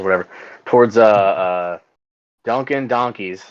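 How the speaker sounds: ordinary delivery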